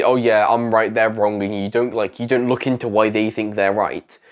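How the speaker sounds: ordinary talk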